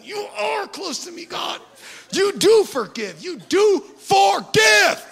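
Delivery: hammed-up emotional prayer. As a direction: overwrought cry to God. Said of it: "they're getting emotional all of a sudden".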